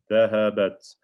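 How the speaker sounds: Dhahabat with the final ta stopped short; clear sukoon on ta. Shown as a demonstration. Teacher models the feminine ending, Dhahabat said with a crisp closed t. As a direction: say Dhahabat, closing on a crisp silent t. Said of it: "put a sukoon on it".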